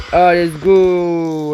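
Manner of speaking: celebrating gamer; triumphant cheer. Real, not acted. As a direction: stretch it out slowly like a victory call. Celebrates rescue in game.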